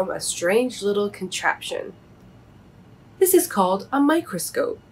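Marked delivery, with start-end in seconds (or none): 3.2-4.8 higher, showing it off lightly; a hint of a kindly teacher owl. Old Owl shows Bobby the microscope, lightly higher. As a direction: present it like a proud teacher.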